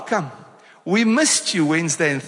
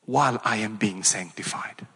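whispered confession; 10 semitones lower, 5 dB quieter than usual. Acted. Congregation repeating verse, confessional hush.

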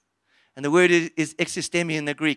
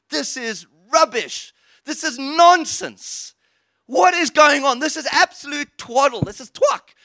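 scornful mocking; 8 semitones higher, 6 dB louder, slower than usual. Scoffers voiced mocking.

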